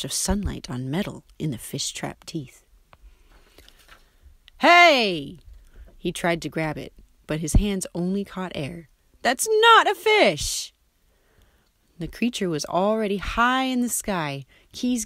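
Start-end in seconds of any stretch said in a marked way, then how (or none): 4.6-5.4 sharp, loud, high yelp; startled shout. A narrator's reading. Pete yelling at the creature stealing his keys, loud and startled. 9.2-10.7 much higher, loud and slow cry; outraged protest. A narrator's reading. Pete crying out at the creature flying off with keys, high and slow.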